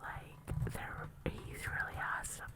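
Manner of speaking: hushed breathy whisper. Real, not acted. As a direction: whisper it close to the mic. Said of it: "Why are you whispering?".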